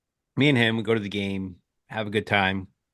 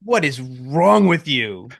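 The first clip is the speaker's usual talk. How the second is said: slow, louder incredulous demand; exasperated disbelief; 4 dB louder, much slower than usual. Retold or imitated. To the woman who jinxed the game, slow exasperated demand. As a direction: drag it out in disbelief. Rant about jinxing neighbor.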